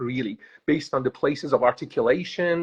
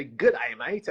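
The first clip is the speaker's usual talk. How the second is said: a quick touch of Aussie accent; lightly mimicked Australian accent. Shown as a demonstration. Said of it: "the rest is American".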